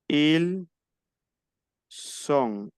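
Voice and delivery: "ils", pause, then "sont" with a voiceless S; S sound. Shown as a demonstration. Teacher splits the être form, "sont" starting on S. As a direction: separate "ils" and "sont", crisp unbuzzed S. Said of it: "la pronunciación".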